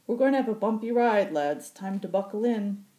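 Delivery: high, loud, quick gruff call; rally the lads with a grin; brash pilot warning. Acted. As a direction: pilot warning the troops of a rough landing. Occam voiced, warning the troops.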